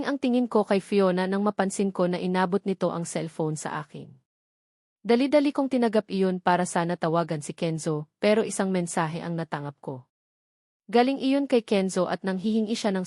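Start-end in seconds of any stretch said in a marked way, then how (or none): none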